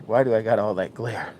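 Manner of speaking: normal talking voice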